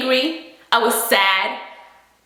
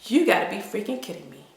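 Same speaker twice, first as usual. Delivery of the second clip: muttered disbelief; 7 semitones lower, 8 dB quieter, faster than usual. Retold or imitated. Disbelief after he won't answer.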